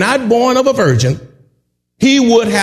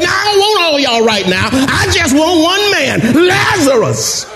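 raised, higher preacher's call to the dead man; commanding call; 8 semitones higher than usual. Retold or imitated. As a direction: call him out of the grave, building up. Jesus calls Lazarus from tomb.

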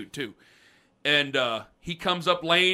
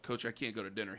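much softer, lower sheepish plea; 7 semitones lower, 15 dB quieter, faster than usual. Retold or imitated. Player pleading with coach about dinner, sheepish mumble. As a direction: barely get the words out. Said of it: "I'm like".

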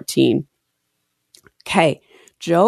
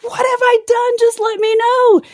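much higher, pleading desperately; desperate plea. Retold or imitated. Host voices Job begging God, much higher and desperate. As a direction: mimic a desperate cry to God. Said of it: "he almost starts sounding desperate".

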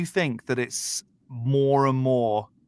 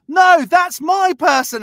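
much higher, loud, slow shout across the hall; shouted claim; 11 dB louder, slower than usual. Retold or imitated. A stranger across the theatre shouting to claim the message. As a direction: yell from the far side to claim it.

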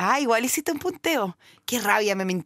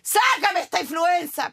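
high, drawn-out cry for help; slow hammed-up yell to the heavens; mock pleading shout; 4 semitones higher, much slower than usual. Daughter asking dad above to cure her flu, mock pleading shout. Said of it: "le grito a mi papá y grito".

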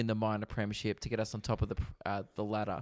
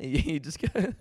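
ordinary delivery

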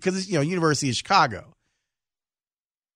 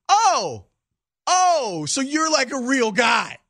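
high, amazed realization; suddenly impressed, voice jumps up; surprised; 9 semitones higher, slower than usual. Retold or imitated. Awed reply to the doctor.

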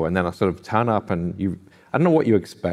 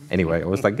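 ordinary talk, nothing marked

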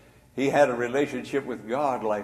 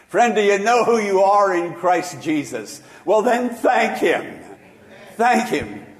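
higher, louder call to the congregation; preaching; 7 semitones higher, 7 dB louder than usual. Acted. Calling congregation to thank God.

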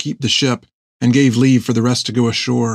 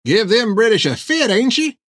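put-on cackling old hermit; 11 semitones higher than usual. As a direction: crow it back, cracked and gleeful. Hank admiring Finn.

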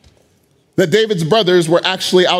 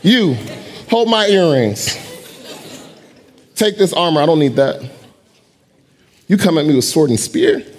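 lower, calm defiance; steady David; 4 semitones lower than usual. Acted. David defying Goliath, a lower, steady challenge. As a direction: settle in and face the giant.

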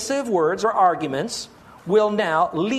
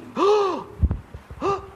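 sharp, high staged gasp; a dramatic pulpit gasp of alarm; mock gasp; 12 semitones higher than usual. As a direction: gasp as if struck by a realization. Realizing he could be deceived.

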